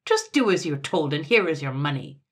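loud, curt order; irritable command; snapping. A narrator's reading. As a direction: put him in his place, testily. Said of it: "snapped Sir Buzz".

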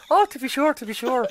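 high, loud hammed-up Irish; stage Irish. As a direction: lay on the Irish cliché thickly. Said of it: "that accent".

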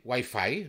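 WIFI said the English way, "uai-fai"; pronunciación inglesa. Shown as a demonstration. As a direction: say wifi as English "why-fye". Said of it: "¿dónde? ¿Y la A?". (wifi a la inglesa, «uái-fai»)

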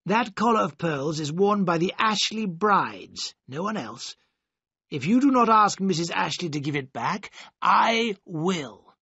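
lightly voiced worried godfather delivering bad news; stern godfather. Godfather voiced in read story.

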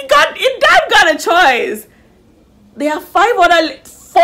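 high, loud, outraged comeback; incredulous retort. Retold or imitated. Answering the contestant's claim about choice with a high, loud incredulous retort. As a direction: snap back at her in disbelief.

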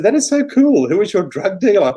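incredulous delighted reaction; excited disbelief. Retold or imitated. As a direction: gush like it's the best news ever. Reacting to a wild prediction.

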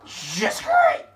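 very high, loud squawk; exasperated yell. Real, not acted. Player fed up with his luck, exasperated yell. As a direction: yelp it in sarcastic despair.

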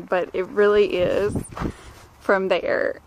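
ordinary talk, nothing marked

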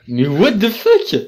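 slow, high disbelief; astonished. Real, not acted. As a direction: react in disbelief. Astonished at avatar.

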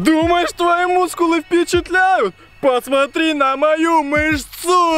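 high, slow bragging; boastful fighter. Acted. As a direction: show off your muscle slowly. Showing off as fighter.

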